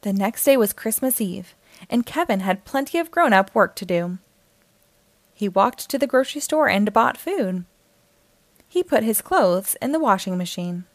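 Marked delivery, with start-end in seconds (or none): none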